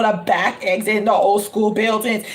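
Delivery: ordinary talk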